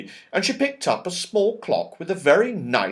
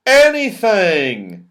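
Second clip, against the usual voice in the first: slow, louder, savoured word; Moonface; 5 semitones higher, 7 dB louder, slower than usual. Acted. Moonface promising the children, slow and grand. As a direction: relish the word. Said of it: "said Moonface".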